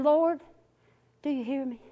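soft, falling plea to the Lord; quiet prayer. Retold or imitated. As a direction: ask it softly, almost to yourself. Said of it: "I probably didn't even say it that loud".

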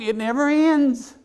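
slow, high, weary complaint; exasperated groan. Retold or imitated. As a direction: drag it out, worn down. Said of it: "I'm like".

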